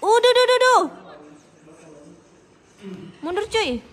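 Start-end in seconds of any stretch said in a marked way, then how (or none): 0.0-0.8 high, loud panicked yelps; panicking gamer. Real, not acted. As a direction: panic as your hero goes down. Hero dies mid-fight. (gamer panik berteriak tinggi)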